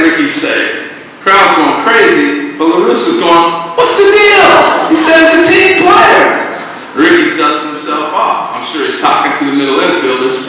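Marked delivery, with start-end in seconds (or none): 3.7-6.5 high, loud, slow outraged yell; furious shout. Retold or imitated. Manager La Russa yelling about his base stealer, outraged shout. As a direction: bellow it in disbelief.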